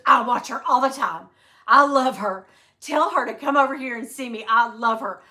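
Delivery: loud, gushing fan talk. Retold or imitated. Customer telling the husband she loves his wife, loud and gushing. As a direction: gush like a superfan.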